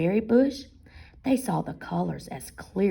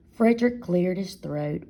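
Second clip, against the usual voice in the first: ordinary delivery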